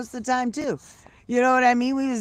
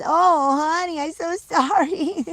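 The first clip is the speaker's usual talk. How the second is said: high, loud doting sympathy; cooing apology; 7 semitones higher, 5 dB louder, slower than usual. Real, not acted. Grandma apologising to the scared pet, high cooing. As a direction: fuss over a little pet with pity.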